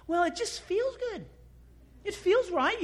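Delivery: high-pitched, airy mimicry of a weak answer; mock feeble excuse. Believer giving a poor reason for faith, mock feeble.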